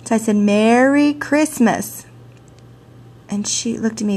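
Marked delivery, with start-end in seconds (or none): 0.4-2.1 slow, deliberately shaped "Merry Christmas"; child retrying the greeting after not being understood; careful retry. Retold or imitated. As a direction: try "Merry Christmas" again, slowly and clearly. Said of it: "maybe I said it wrong. Let's try it again".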